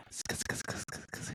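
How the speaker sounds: soft unvoiced sk sk clicks; whispered ASMR mouth sounds. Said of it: "someone's in there doing A S M R".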